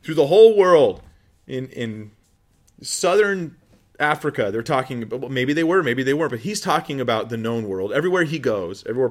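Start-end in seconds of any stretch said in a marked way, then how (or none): 0.0-5.2 mock Paul proclaiming. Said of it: "Paul's not like".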